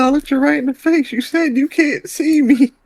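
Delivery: very high, slow accusing grandma. Acted. Grandma accuses grandson, very high and slow. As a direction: put on a high old-lady voice, accusing. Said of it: "He said".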